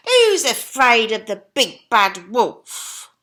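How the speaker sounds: high, loud cocky pig voice; chuckling pig. Acted. Horace boasting to his brothers, high loud chuckle. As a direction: toss it off with a chuckle. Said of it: "chuckled Horace".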